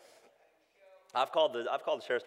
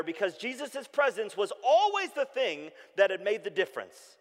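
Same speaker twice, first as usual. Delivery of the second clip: higher, louder preaching; 9 semitones higher, 6 dB louder than usual. Acted. Pastor preaching about disciples' loss, rising. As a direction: build it like a sermon climax.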